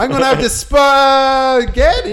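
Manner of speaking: high, loud, slow mock shout; possessed outburst. Demon covering a slip about lunch, hammed-up shout. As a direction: bellow it, stretching the word being corrected.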